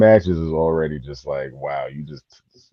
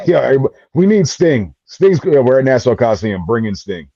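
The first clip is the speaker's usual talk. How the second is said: mock booker; 7 semitones higher, 6 dB louder than usual. Mock booker calling for Sting.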